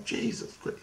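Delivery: whispered Jesus Christ; stunned whisper. Retold or imitated. Learning his friend was really there, stunned whisper. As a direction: breathe it out in shock.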